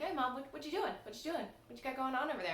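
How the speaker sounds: put-on high-pitched eager kid interrupting; imitating little son. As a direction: mimic a small child pestering mom with questions. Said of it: "kept running into the shot to be like".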